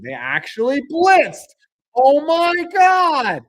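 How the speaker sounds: high, loud disbelieving exclamation; excited outburst. Real, not acted. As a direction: cry out at the play, stretching "Oh my God". Reacting live to a blitz.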